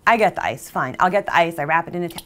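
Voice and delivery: usual speaking voice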